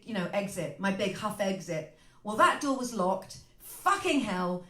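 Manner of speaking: usual speaking voice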